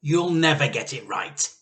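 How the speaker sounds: loud, high exasperated snap; Missus Cranky snapping. A narrator's reading. Missus Cranky tells George to quit, loud and fed up. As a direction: throw it out, fed up. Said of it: "Missus Cranky said".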